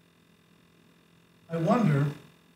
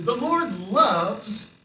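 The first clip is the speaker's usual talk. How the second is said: scripture reading; 4 semitones higher, 4 dB louder, slower than usual. Shown as a demonstration. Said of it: "it says in verse two".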